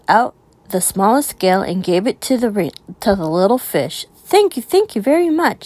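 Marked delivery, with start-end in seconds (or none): 4.1-5.6 high, bubbly thanks; bubbly and playful. Acted. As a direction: bubble over with glee. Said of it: "said the little blue fish, bubbling playfully".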